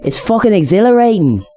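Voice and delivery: high-pitched Scottish-Australian mash-up; Scottish-Australian accent. Acted. Asking co-host about coffee, Scottish-Australian accent. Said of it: "I just put Scottish into Australian".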